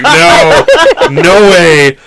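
retold no through laughter; laughing refusal. Retelling his reply to a commenter, laughing.

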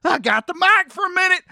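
eager country guy talking fast; Terry voice. Acted. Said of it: "It's your buddy Terry".

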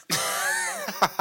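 high, loud stalling um; caught stalling. Retold or imitated. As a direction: squeak out a guilty um. Caught by truancy officer.

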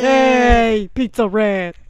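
loud New York call; New York accent. Pretend. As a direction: bark it like a street New Yorker. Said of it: "My New York accent".

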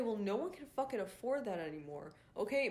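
normal talking voice

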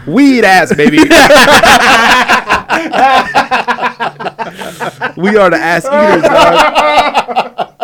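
loud, high, laughing brag; laughing excited boast. Real, not acted. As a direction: crow it out, cracking up. Laughing hyped boast about group.

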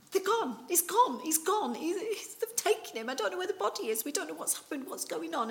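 high, frantic repeating of loss; a grieving woman panicking at the empty tomb; distressed panic. Acted. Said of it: "distress that this poor woman's under".

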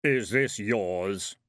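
slow, heavy voice of Death; heavy thundering voice. A narrator's reading. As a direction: let it rumble like distant thunder. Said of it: "The voice was heavy and all around her like thunder".

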